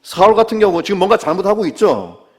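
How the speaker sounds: normal talking voice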